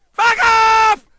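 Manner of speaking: very high full-throated scream; screamed dismissal at top volume; retold furious scream. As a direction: explode at him, acting it out. Exploding at the man, retold.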